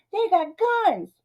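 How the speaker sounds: high-pitched mock alarm; panicky Myron. Myron arguing with his conscience about gangsters, high comic panic. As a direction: squeal it like a hammed-up coward. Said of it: "Myron's like".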